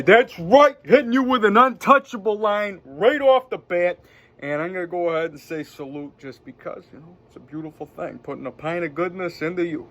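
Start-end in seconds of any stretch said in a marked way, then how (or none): 0.0-4.0 higher, louder boast; excited, high-pitched brag; triumphant hype. Real, not acted. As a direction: crow about the line you just landed. Hyping own movie quote.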